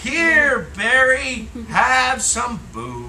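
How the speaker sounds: reading back. Shown as a demonstration. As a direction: read it back slowly and carefully. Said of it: "Read that back to me".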